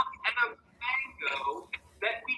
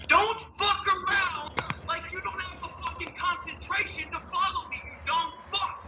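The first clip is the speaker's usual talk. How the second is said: angry; 6 semitones higher than usual. Real, not acted. Angry outburst at chat.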